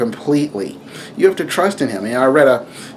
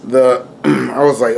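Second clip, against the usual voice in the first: usual speaking voice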